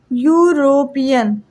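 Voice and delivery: "यूरोपियन" slowly sounded out in parts; syllable by syllable. Shown as a demonstration. Teacher showing students how European is written, slow syllables. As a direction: draw out "यूरोपियन" as if writing it piece by piece. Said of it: "ऐसे लिखा जाता है".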